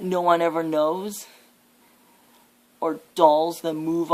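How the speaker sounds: a villain mocking what the listener dreads; taunting villain. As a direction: goad them with each fear, voice climbing.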